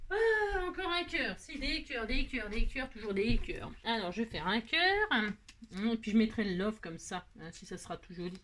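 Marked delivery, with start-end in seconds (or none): 0.1-3.7 lassitude amusée. Real, not acted. Lassée de faire des cœurs.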